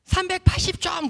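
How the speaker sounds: high, drawn-out shouted answer; slow, high-pitched holler; calling back. Retold or imitated. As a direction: call the score across a field. Class president calls back his score.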